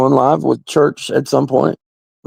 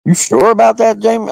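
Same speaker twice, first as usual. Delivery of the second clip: skeptical mock question; 9 semitones higher than usual. Doubting the singer in a mock skeptical voice. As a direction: raise a suspicious eyebrow in your voice. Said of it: "I was like".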